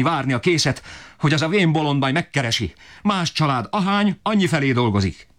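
very high, strained, desperate tirade; father berating the family for lack of bread, shrill-high rage; raging father. Acted. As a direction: screech out the injustice of it all.

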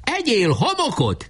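high, drawn-out taunt; high-pitched mock yell; frenzied battle cry. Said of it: "kiáltott nekielvülten Barabás".

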